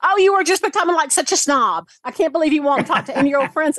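high-pitched mock indignation; offended girlfriend. Acting out her offended Southern girlfriend.

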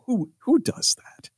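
breathy whisper, still laughing; whispered through laughter. Real, not acted. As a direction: whisper it while giggling. Laughing aside after squeal.

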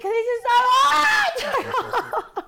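slow, high, loud laughing yell; laughing shout. Real, not acted. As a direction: shout and laugh through the burn. Chili burning, laughing through it.

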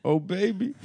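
high-pitched rapper ad-lib impression. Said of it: "such a good [name] impression".

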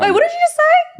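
high, loud mock shock; high indignant squeal; mock outrage. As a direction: play scandalised, pitch way up. Mock outrage at partner's line.

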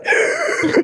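high, acted gasps for air; mock choking gasp; imitated gasping. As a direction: mimic someone who can't breathe. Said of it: "like, she can't breathe".

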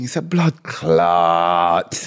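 low, drawn-out fed-up curse. Shown as a demonstration. Driver stuck at traffic lights, fed-up drawl. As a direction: drag it out slowly, exasperated. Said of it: "That's when you're fed up".

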